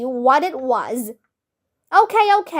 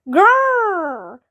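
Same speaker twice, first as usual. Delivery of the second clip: bear growl; 5 semitones higher than usual. A narrator's reading. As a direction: growl like the bear about to bite. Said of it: "growled the bear".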